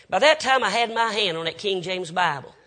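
normal talking voice

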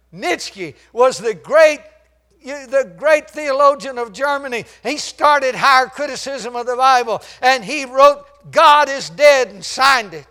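loud, high, slow-starting preacher shout; full-force preaching. Acted. Preacher denouncing Nietzsche to the congregation, loud high preaching. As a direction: thunder it from the pulpit.

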